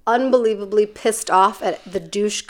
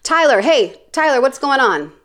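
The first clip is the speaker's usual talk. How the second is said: higher, cheery called-out greeting; Carla; 4 semitones higher than usual. Retold or imitated. Bounty hunter greets a man approaching her, imitated cheery call. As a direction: impersonate a bounty hunter hailing someone approaching. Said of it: "So this is me doing her".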